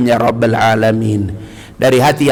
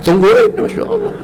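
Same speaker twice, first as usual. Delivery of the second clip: high mock exclaim. Voicing waiting collectors, high.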